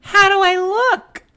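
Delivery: loud, very high stage voice; squeaky, put-on character question; [name]'s character voice. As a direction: ask for approval in a piping voice. [name] tries on hat.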